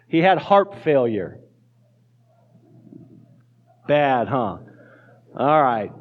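normal talking voice

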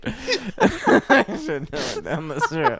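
rueful laughing repeat. Real, not acted. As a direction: say it again through laughter. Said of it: "repeated laughing".

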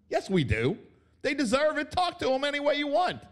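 high, fired-up insistence; heated rant. Real, not acted. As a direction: push it up, worked up. Ranting, pitch jumps.

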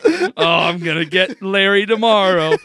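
loud put-on plotting voice; mock scheming. Joking about sabotaging the roommate while sleepwalking, mock scheming. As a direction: ham up a sleepwalker plotting revenge.

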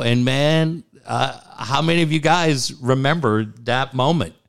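ordinary delivery

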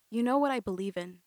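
plain reading voice

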